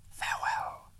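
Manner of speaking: soft, far-off whisper; eagles' farewell. A narrator's reading. Eagles calling farewell as they fly off, whispered. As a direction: breathe it like a call fading in the wind. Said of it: "they cried".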